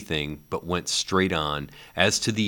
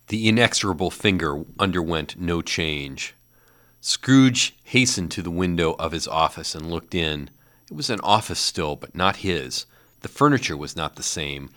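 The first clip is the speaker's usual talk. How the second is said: plain reading voice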